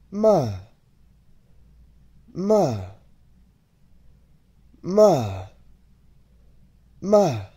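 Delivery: short "ma" falling sharply from high; falling Mandarin tone on "ma"; fourth tone. Shown as a demonstration. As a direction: drop each "ma" quickly from a high, strong start. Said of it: "Let's take each one at a time".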